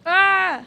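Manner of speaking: loud, high cry of dismay; frustrated scream. Real, not acted. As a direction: yell out as the game beats you. Loses progress in game.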